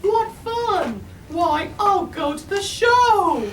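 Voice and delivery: high, loud, gleeful bird voice ending in a shout; sing-song lazy bird Maisie. A narrator's reading. Maisie spots the circus from the sky, high gleeful bird voice. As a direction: squeal it out like a giddy lazy bird. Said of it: "she sang out".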